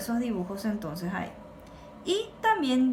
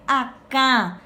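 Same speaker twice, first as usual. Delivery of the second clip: "acá" with a nasal, stressed last A; "acá" accented on its last syllable, through the nose; stress on final A; 5 dB louder than usual. Shown as a demonstration. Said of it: "con acento en la última A".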